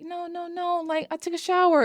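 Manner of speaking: high, whiny protest; clingy whine. Retold or imitated. Nikki begging Bear not to move, high whine.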